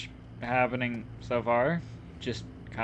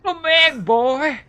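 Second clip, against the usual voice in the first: mock yell; 13 dB louder, slower than usual. Mimics NPC calling dog.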